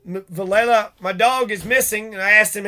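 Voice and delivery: ordinary talk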